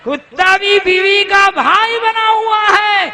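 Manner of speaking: fiery preacher's shout; preaching shout. Real, not acted. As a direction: shout the line again to the crowd. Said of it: "معلوم ہے کہ کتا بھی".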